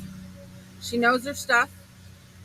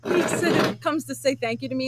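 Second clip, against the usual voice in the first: ordinary talk, nothing marked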